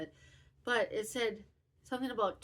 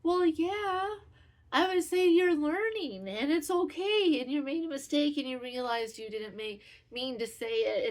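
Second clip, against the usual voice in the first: higher, louder, reassuring tone; mock kind reassurance, higher; 6 semitones higher, 6 dB louder than usual. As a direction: reassure a friend brightly. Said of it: "And I said".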